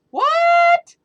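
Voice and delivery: very high, loud shocked cry. Acted. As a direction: jolt of disbelief. Maisie shocked by hatchling.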